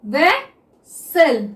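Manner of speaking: Officer drawn out very slowly; teacher dictating officer to students; slow dictation. Shown as a demonstration. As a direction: stretch officer as if writing it down. Dictating while writing shorthand.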